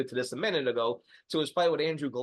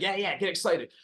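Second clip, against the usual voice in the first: mock excitement; 7 semitones higher than usual. Mocking telling him to celebrate.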